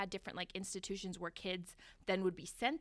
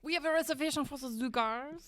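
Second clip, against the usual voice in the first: put-on French accent; 7 semitones higher, 7 dB louder than usual. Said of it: "Is that your French accent?".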